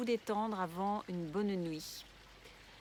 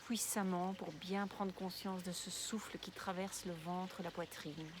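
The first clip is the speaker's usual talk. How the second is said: guided meditation; 6 dB quieter than usual. Real, not acted. Guide une relaxation de yoga nidra. (voix douce et posée de méditation guidée)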